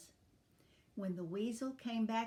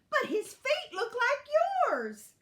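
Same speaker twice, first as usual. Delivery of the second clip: very high, loud insisting; insistent; 8 dB louder than usual. A narrator's reading. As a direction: insist excitedly at the top of the voice. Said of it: "insisted Dot".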